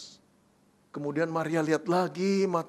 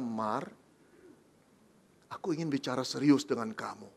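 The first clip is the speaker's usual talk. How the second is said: low, soft and grave; low, soft Joseph to Mary; serious hushed Joseph; 7 semitones lower, 5 dB quieter than usual. Acted. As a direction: quietly call her in for a serious talk. Joseph opens serious talk.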